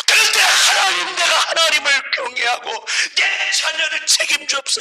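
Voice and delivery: loud, high pulpit shout; fired-up preacher's cry; preaching at full force. Real, not acted. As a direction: proclaim it forcefully to the congregation.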